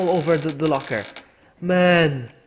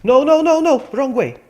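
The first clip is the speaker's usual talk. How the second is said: high, loud frantic no; panicked shouting; 11 semitones higher, 10 dB louder than usual. Real, not acted. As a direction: yell at the game. Character heading wrong way.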